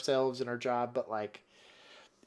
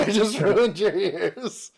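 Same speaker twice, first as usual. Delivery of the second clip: laughing; 6 semitones higher, 5 dB louder, much slower than usual. Real, not acted. Laughs after loud radio.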